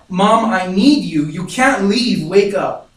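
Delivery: loud, high, drawn-out re-enacted plea; emotional pleading. Child begging unconscious mother not to leave, desperate and emotional. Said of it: "now I'm crying, full on emotional".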